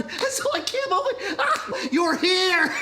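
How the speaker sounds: high, slow, laughing disbelief; laughing with joy. Acted. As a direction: laugh the words out in wonder. Peter overwhelmed seeing Jesus.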